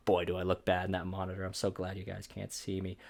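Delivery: normal talking voice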